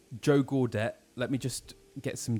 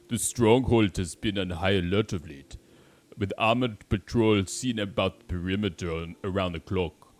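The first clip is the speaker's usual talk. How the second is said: attempted Austrian accent sliding into an African one; accent drifting African; 3 dB louder than usual. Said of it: "some sort of African accent".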